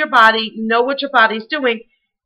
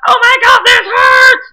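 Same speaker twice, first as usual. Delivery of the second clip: high, loud hammed-up cry of pain; loud overacted complaint; mock yell of pain; 12 semitones higher, 8 dB louder than usual. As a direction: yell it out like the squat is killing you. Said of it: "I'm not saying yell out".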